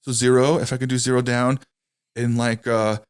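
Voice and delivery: hesitant, halting mock of a nervous rookie. A rookie salesman pitching a customer, imitated nervous and halting. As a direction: stumble through it like a scared new salesman. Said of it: "he's, like, so nervous".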